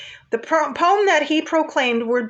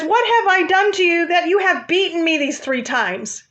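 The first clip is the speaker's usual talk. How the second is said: higher-pitched mild protest; lightly voiced aggrieved donkey; 5 semitones higher than usual. Donkey to Balaam after three beatings, mildly aggrieved. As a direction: plead lightly as the beaten donkey. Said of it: "And the donkey says".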